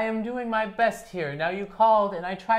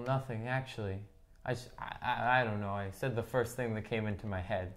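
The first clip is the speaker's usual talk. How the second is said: softer, trailing, caught-out mumble; sheepish mumble; 8 semitones lower, 9 dB quieter than usual. Acted. Son backing down to his mother, sheepish mumble. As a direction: mumble it like you've been caught.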